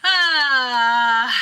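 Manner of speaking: long loud "ah"; drawn-out acted yell; waking scream. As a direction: cry out on waking from a nightmare. Waking from a nightmare.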